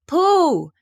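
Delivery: high, loud call; shout. Acted. Christopher Robin shouts to Pooh at the gate. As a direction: call out across the wood. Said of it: "shouted Christopher Robin".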